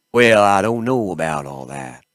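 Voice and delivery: attempted Southern sliding into Texan; Texas accent. Game master practising the doctor's voice, Texas accent. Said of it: "No, that's more Texas".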